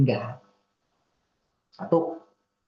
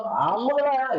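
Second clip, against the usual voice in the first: exasperated sigh; 8 semitones higher, much slower than usual. Retold or imitated. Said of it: "Ada cewek yang suka ngomong ceweknya cepet".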